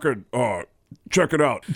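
deliberately bad, lightly voiced metal singer; a hint of a laid-back metal singer. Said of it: "it was the worst [name]".

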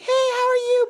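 very high, sweet mom greeting; singsong mock-sweet mom; nice mom voice. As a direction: imitate a nice mother, way up high. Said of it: "this very nice mom voice".

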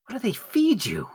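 high, slow teasing drawl; mocking taunt. Taunting the enemy.

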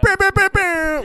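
high, slow horn blasts; air horn noise. Pretend.

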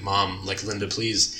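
low, very soft plea; sad pleading. Retold or imitated. John at his dying mother's side, sad plea. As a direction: plead softly, imitating a grieving son. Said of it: "what's very sad is".

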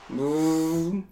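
soft, drawn-out fly buzz. Acted. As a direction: buzz softly like a circling fly. Acting the fly's buzz.

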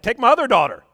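high, loud panicky offer; terrified offer. Retold or imitated. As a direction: blurt it out in fright. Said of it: "He's like".

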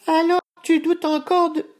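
very high squeaky mouse; tiny mouse voice. Acted. Tiny mouse ogre speaking.

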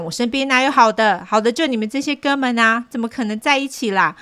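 half-acted yell of despair; retold shout. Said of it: "我那时大喊".

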